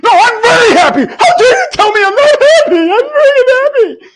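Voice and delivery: mock indignant, rising to a high crying shout; hammed-up high wail of denial; defensive insistence. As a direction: play someone offended at being called unhappy. Mimicking defensive people insisting they're happy.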